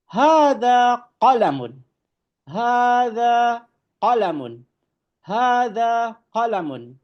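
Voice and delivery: slow, high, careful Arabic recitation of "Hadza babun"; Arabic model reading. Shown as a demonstration. Teacher reading Arabic to students, slow and emphatic. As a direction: drawl out "Hadza babun" for the class to copy. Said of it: "Ini adalah sebuah pulpen".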